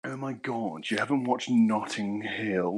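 slow, drawn-out mock-posh flirt. Friend re-enacts Jack's flirting for the others, posh voice. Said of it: "is Hugh Grant voice".

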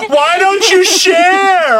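high, drawn-out imitation of a complaint; mock whiny yell. Host imitating co-host demanding he share, mock yelling. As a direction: mimic her complaint, high and stretched out.

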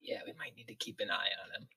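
unvoiced conspiratorial whisper; very soft, secretive whisper to an ally. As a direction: whisper it so he can't overhear. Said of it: "No, we're whispering".

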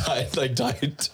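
slow, laughing through the words. Real, not acted. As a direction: giggle out the word slowly. Laughing about diet town.

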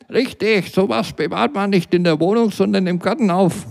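put-on a half-deaf elderly passer-by, puzzled; old man. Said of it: "meinte der Senior".

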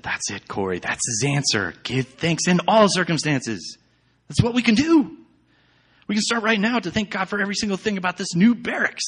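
builds from calm to high, loud and quick; Betsy urging Corrie to give thanks; growing eager joy; rising excitement. A narrator's reading. Betsy's joyful insistence, rising.

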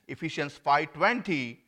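ordinary talk, nothing marked

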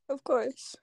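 glitching audio. Real, not acted. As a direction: call cutting out, glitching audio. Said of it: "your audio is so bad. You keep cutting out".

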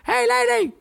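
very high, loud, goofy comic screech; Jerry Lewis. Retold or imitated. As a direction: heckle in a squealing zany-comedian voice. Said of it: "básicamente haciendo Jerry Lewis".

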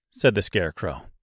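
plain reading voice

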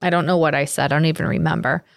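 usual speaking voice

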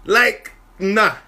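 usual speaking voice

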